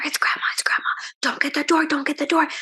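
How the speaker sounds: hushed, breathless whisper to a sister; excited whisper. A narrator's reading. Girls whispering so Mom answers the door. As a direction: whisper it so Mom won't hear. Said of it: "whispered to each other".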